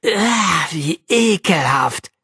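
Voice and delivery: drawn-out, revolted groan of words; disgusted outcry. A narrator's reading. Arabella recoils from the cat's find, disgusted. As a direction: recoil in disgust, holding back a screech. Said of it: "rief Arabella plötzlich und gab sich Mühe".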